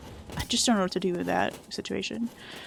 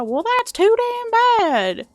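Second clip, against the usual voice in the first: high, slow mock old-man growl; hammed-up grandpa brushing off the kid; gruff grandpa voice; 12 semitones higher, 6 dB louder, slower than usual. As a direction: ham up a cranky grandpa's retort. Grandpa's reply in movie.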